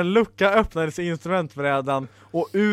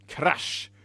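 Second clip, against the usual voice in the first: plain reading voice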